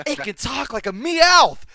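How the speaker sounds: high, loud and slow, amazed; excited Ash shout. Retold or imitated. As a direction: yell it in wide-eyed wonder. Retelling abridged Pokemon scene.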